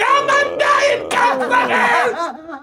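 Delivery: slow, drawn-out laughing shout; defiant yell. Retold or imitated. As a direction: bellow it slowly through laughter. Retold Bobby's defiant yell.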